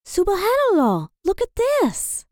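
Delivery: amazed. A narrator's reading. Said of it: "said Iman".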